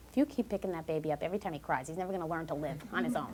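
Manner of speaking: hurried, hushed busybody advice; meddling visitor. Retold or imitated. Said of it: "someone might walk in and say".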